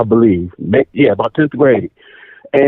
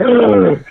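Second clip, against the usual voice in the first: high mock gasp of shock; re-enacted gasp; 8 semitones higher than usual. Son seeing the drum set arrive, re-enacted gasp. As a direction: gasp as if stunned by a surprise. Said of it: "I was like".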